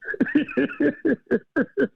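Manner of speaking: high-pitched burst of laughter; real amused laugh. Real, not acted. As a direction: crack up high. Laughs at late-night call story.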